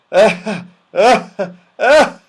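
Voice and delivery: loud rising mechanical panting; plane panting. Acted. The tired aeroplane gasping for breath, loud rising pants. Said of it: "it went".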